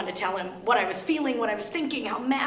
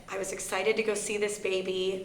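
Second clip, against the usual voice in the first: ordinary talk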